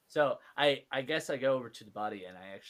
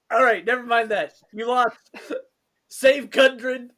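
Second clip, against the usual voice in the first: character voice; 11 semitones higher, 12 dB louder than usual. Acted. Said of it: "Oh, like".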